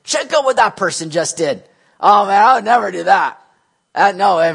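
boasting. Acted. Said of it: "boasting in what we think is good".

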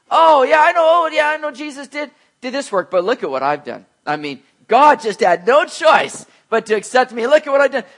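loud, high bragging voice; boastful believer. Pretend. Preacher mocking a boastful believer, loud and high. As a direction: brag loudly and smugly about your own works. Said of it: "how much we'd be boasting".

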